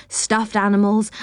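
usual speaking voice

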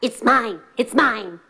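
quick dog voice after a growl. Acted. Dog arguing over the deer, growling dog voice. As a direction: claim it like a dog guarding food. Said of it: "The dog".

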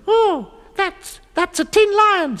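high little goblin voice; hesitant, high-pitched goblin; Binklebonk. Acted. Goblin naming the noise in the forest, high little voice. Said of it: "said Binklebonk".

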